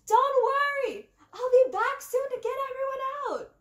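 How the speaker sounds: loud high reassuring yell. A narrator's reading. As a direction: call down to friends far below. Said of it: "I yelled down to Thea".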